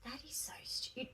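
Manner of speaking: whispered aside, cut short; retold private thought. Student silently judging her lecturer's advice, whispered. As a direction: whisper it under your breath. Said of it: "And I'm thinking".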